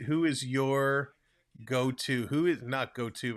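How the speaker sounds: ordinary talk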